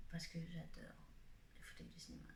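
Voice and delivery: ordinary talk, nothing marked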